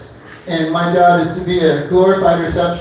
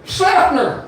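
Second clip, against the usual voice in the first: Seffner as a twangy Sepner, pitched up high; 10 semitones higher than usual. Shown as a demonstration. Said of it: "you can't say Seffner".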